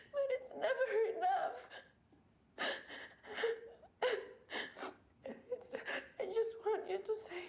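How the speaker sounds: crying through broken, breathy phrases; tearful confession. Acted. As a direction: sob out the exhaustion between gasps. Tearful private confession, alone. (curhat sambil menangis)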